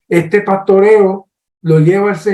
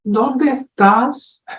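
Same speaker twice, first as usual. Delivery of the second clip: slow, calm, higher gentle question; God calmly calls Adam out of hiding; 6 semitones higher, much slower than usual. Retold or imitated. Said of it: "Dios llega tranquilo y le dice".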